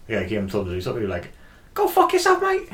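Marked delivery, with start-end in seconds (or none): 1.7-2.7 put-on a much higher, louder yell in a broad Scouse accent. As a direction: shout it like a Liverpool lad telling a pest where to go. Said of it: "like, in a Scouse accent".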